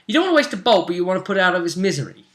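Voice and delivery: high-pitched, rushing retold disbelief; incredulous retort. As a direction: throw the contradiction back at him, voice jumping up. Retelling own incredulous retort.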